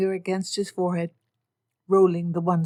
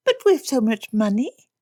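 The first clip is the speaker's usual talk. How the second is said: put-on higher, louder girlish protest; petulant protest; 5 semitones higher, 5 dB louder than usual. Lydia argues with Papa, petulant protest. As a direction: argue back.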